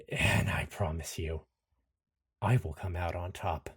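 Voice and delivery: low, soft, resolute vow; quiet defiance. Acted. Anderson promising the villain he will come out on top, quiet defiance. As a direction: push back without raising the voice.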